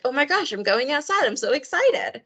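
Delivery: hyped-up dog. Retold or imitated. Volunteer coordinator voicing dogs thrilled to go outside, hyped up. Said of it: "getting really hyped up".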